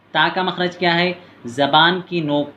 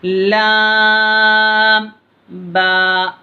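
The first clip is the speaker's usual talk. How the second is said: slow, high, louder chanted lam alif; drawn-out recitation; 5 semitones higher, 5 dB louder, much slower than usual. Shown as a demonstration. Teacher demonstrates the lesson's letters to pupils, slow chanted and louder. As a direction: hold लाम very long, then a falling अलिफ. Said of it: "इसको कम खींचना है".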